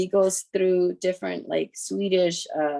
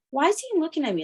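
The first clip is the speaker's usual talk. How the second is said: put-on much higher-pitched child's question; imitated child; 11 semitones higher than usual. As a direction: ask it high, like a curious kid. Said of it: "a lot of kids will be like".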